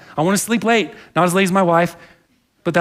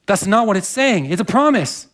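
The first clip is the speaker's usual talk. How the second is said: ordinary talk, nothing marked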